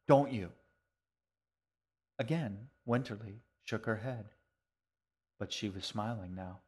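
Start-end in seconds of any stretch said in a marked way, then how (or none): 0.1-0.5 louder, triumphant little question. A narrator's reading. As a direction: press the point with glee. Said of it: "she said triumphantly".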